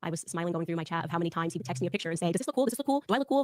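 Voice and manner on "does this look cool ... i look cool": high, eager, repetitive questions; imitating a needy kid brother; annoying kid brother. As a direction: pester for approval like a little brother. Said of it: "really annoying kid brother".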